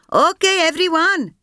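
high call to the troops; shouting. A narrator's reading. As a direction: call out high to rally them. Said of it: "Sampath shouted to her troops".

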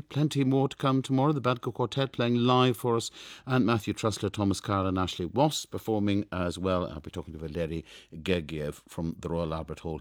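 normal talking voice

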